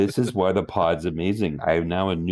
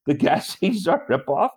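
incredulous echo; 6 semitones higher than usual. Retold or imitated. Incredulous echo of her claim.

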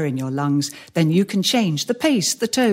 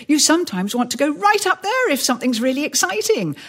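pitched high up to show excitement; 9 semitones higher than usual. Shown as a demonstration. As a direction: lift the voice right up there. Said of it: "play with your voice".

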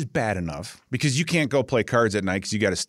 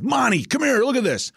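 mock coach call; 13 semitones higher than usual. Coach calling out in retold scene.

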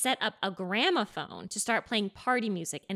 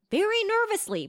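nervous; 10 semitones higher, 4 dB louder than usual. Retold or imitated. Describes nervous singing.

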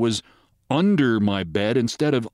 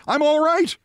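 high, insistent acted yell; mock yell pitched way up; reassuring yell; 13 semitones higher than usual. A narrator's reading. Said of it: "Briggs yelled to reassure her".

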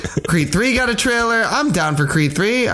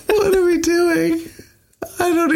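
laughing disbelief; 11 semitones higher than usual. Real, not acted. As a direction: give up through laughter.

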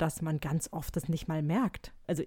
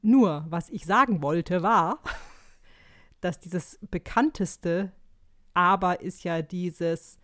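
very slow, weighing each word; slow speech; 5 dB louder, slower than usual. Pretend. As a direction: drag each word out carefully. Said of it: "jetzt werde ich leider nur noch ganz langsam sprechen können".

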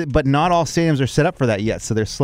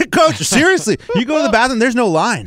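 high, laughing disbelief; laughing excitedly; 8 semitones higher than usual. Real, not acted. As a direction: gush while laughing.

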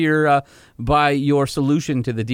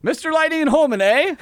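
mock Canadian accent; 12 semitones higher than usual. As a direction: play up a cartoonish Canadian accent. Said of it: "do we have to do it in Canadian".

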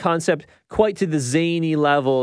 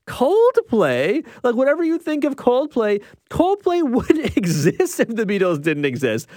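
much higher, disbelieving retort; incredulous; 8 semitones higher than usual. Retold or imitated. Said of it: "And I'm like".

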